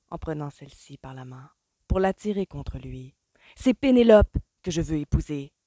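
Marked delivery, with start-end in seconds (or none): 3.6-5.5 emphatic declaration. A narrator's reading. Colin declares his choice.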